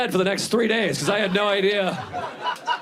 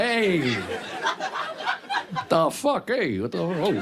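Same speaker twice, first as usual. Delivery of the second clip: Italian tough guy; 3 dB quieter, much slower than usual. Pretend. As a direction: play a hot-headed Italian guy. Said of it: "just go full Italian".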